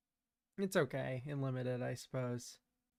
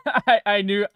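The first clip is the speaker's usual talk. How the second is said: laughing; 7 semitones higher, 13 dB louder than usual. Real, not acted. Laughs at being caught.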